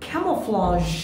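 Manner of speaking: camouflage said slowly as KA-muh-flaazh; correct pronunciation. Shown as a demonstration. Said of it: "এটার উচ্চারণ হচ্ছে". (camouflage ক্যামোফ্লাজ)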